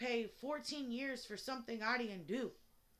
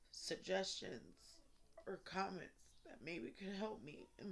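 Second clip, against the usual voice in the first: much softer, hushed sign-off; trying to be quiet; 6 semitones lower, 7 dB quieter than usual. Real, not acted. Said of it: "so I'm trying to be quiet".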